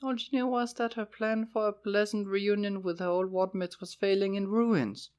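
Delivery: ordinary delivery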